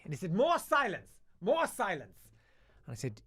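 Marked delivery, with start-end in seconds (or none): 0.3-2.2 high, loud mock command; hammed-up cry for order; flustered angry teacher. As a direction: imitate a teacher losing his temper. Teacher demanding more silence.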